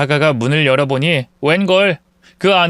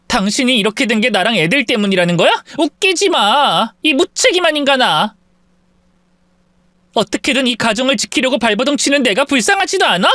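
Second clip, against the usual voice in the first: anguished anger; 7 semitones higher than usual. Acted. Wife shouting at husband.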